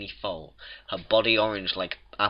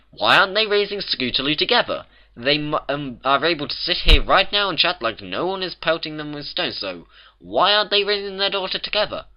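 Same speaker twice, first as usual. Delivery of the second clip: high, loud, insistent questioning; exasperated argument; 8 semitones higher, 5 dB louder than usual. A narrator's reading. Twilight challenges the bakers' story, exasperated questioning. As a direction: press the point with rising indignation.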